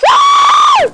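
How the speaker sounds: very high-pitched shriek. Real, not acted. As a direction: let out a piercing, held shriek. Screaming during game.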